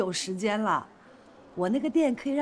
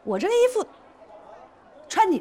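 high, loud offended protest; affronted retort; 9 semitones higher, 4 dB louder, faster than usual. Acted. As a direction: bristle at a remark about your clothes. Bristles at criticism of her clothes. (不服气地高声反驳)

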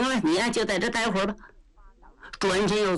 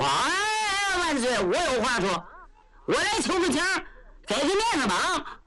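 拔高嗓门的憨直老将, 大大咧咧地讨情; 扯着高嗓门像个糊涂老粗求皇上; 焦廷贵求情; 7 semitones higher than usual. Acted. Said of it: "又听见五班当中有人说". (高声憨直的老将口吻)

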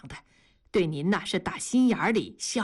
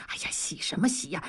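fast hushed whisper, softer; urgent whisper; 3 semitones lower, 4 dB quieter, faster than usual. Acted. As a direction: hurry her along under the breath. Husband whispering urgently to wife. (急促的耳语)

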